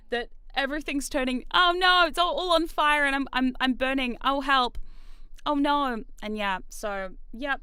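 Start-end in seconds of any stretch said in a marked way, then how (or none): 1.5-6.0 hammed-up, louder cries of help; mock panic. Streamer joking her week is on fire, mock panic. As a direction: pretend to burn up in a comic panic.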